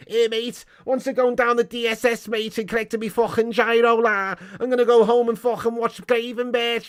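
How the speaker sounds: high, exaggerated Scouse voice; hammed-up mock Scouse patter; Scouse accent. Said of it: "it should have been".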